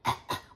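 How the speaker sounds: mock choking cough; imitated cough. Imitating passengers choking on mace, mock cough. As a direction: fake hacking cough. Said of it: "Everybody's choking and coughing".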